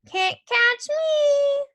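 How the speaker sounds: high, loud, drawn-out taunt; singsong little-kid yell; hollering toddler. A narrator's reading. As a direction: holler it teasingly like a small boy running off. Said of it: "he hollered".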